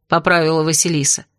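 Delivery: usual speaking voice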